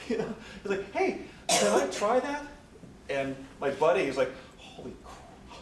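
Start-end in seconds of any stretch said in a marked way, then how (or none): 0.9-2.4 high, loud, slow eager ask; mock eager boss; curious executive. Board member voiced in story. 4.6-5.6 breathed slowly under the breath; mock stunned aside; shocked whisper. Partner's shocked reaction.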